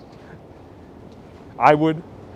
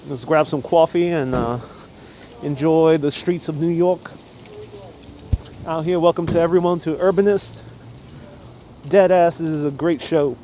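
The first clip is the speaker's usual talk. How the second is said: New York accent; 3 semitones higher than usual. Shown as a demonstration. Vlogger welcoming viewers to the streets in a hammed-up New York accent. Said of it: "talk in the New York accent".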